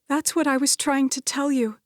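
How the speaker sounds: high, strained, near tears; tearful. Acted. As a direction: plead through tears. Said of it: "Her voice broke".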